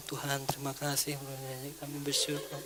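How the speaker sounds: very soft mumbled prayer; inaudible prayer. Acted. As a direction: pray far too quietly for others to hear. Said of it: "gara-gara suara kita nggak nyaring".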